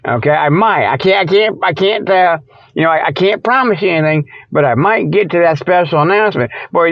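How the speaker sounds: normal talking voice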